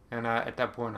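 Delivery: normal talking voice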